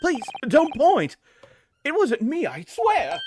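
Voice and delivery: high, slow, pleading jitters; nervous voice. Acted. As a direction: beg like a cornered juror. Accused juror panics at pointing.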